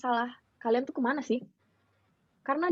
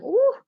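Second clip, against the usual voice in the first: high, loud "ooh"; a sudden high whoop of an "uh"; exclaimed "uh"; 13 semitones higher, 6 dB louder than usual. Retold or imitated. Exclaims over an unexpected incident.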